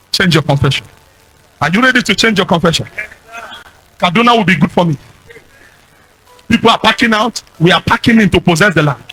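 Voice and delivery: normal talking voice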